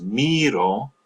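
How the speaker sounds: miro stressed on the first syllable, MEE-ro. Shown as a demonstration. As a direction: stretch the i in miro, light ending. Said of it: "stress placed on the letter I".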